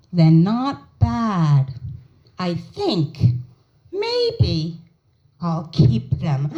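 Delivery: slow, drawn-out reluctant verdict; grudging approval. Retold or imitated. Mother grudgingly approves new sneakers on camera, slow and reluctant. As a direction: concede each word as if it hurts. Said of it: "looks dead in the camera and goes".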